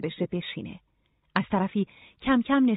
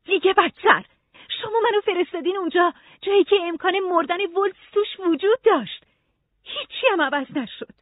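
high-pitched angry protest; 11 semitones higher than usual. A narrator's reading. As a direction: throw the blame back, pitch way up. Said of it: "عصبانی گفت". (با عصبانیت و صدای زیر)

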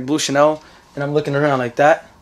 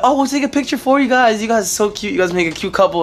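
high, gushing tourist voice, fading as it goes; high sweet gush over a cute couple; 7 semitones higher than usual. Retold or imitated. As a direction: coo it like a delighted tourist.

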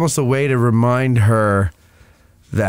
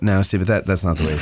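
ordinary delivery

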